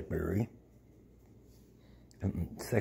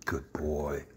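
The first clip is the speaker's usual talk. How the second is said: soft whispered praise; whispering; 4 semitones lower, 8 dB quieter than usual. Real, not acted. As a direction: breathe it to the dog.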